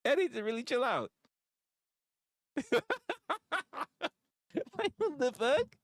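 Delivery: laughing disbelief, cranked way up; mock exasperation. To viewers, acting out his reaction to people losing it, high mock exasperation. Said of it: "And I'm just like".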